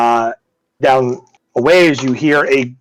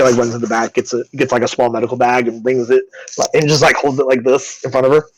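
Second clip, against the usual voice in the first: ordinary delivery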